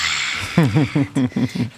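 risada baixa e arrastada; risada grave. Real, not acted. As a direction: ri baixinho e demorado. Ri do Banho de Mar. (risada grave e longa)

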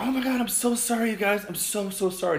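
high, gushing apology; loud, high mock-mortified voice; imitated apologetic guest. Drunk guest apologizing for broken glass.

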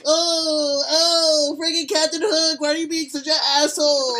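loud, high cartoon sidekick voice; over-the-top high-pitched pirate lackey; Smee. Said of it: "this is my Smee impression".

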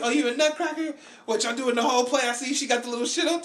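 high-pitched comic teasing; imitated heckling comic. Retelling a comedian roasting someone in costume, high mock teasing. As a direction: rib them in a high imitated stage voice.

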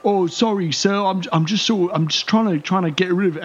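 high, flustered apologetic guard; nervous apology. Acted. Guard apologising to his angry captain, high and flustered. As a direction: grovel quickly to the boss, voice high.

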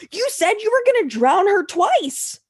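high-pitched, indignant retort; acted outraged protest, pitch way up; incredulous comeback. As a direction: throw the accusation back in disbelief.

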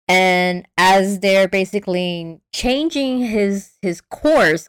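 ordinary talk, nothing marked

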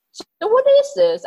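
a hint of a baffled man, pitched high. Imitating the baffled man asking, high.

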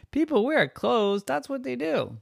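put-on high, insistent kid voice; high-pitched matter-of-fact retort; exasperated girl. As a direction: argue back like a fed-up child. Girl argues back.

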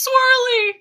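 very high, loud wail; anguished wail. Retold or imitated. Carlos losing the swirly slide, high loud wail. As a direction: cry out as the slide is taken away. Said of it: "and Carlos goes".

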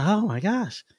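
puffing like after a long walk; out of breath. Retold or imitated. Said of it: "You out of breath?".

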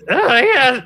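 high-pitched, apologetic gnome voice; flustered little gnome. Acted. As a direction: explain haltingly in a high gnome voice.

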